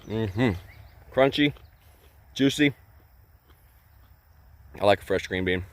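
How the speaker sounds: talking with mouth full; chewing loudly while talking; messy loud eating. Real, not acted. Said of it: "Excuse me for talking with my mouth full".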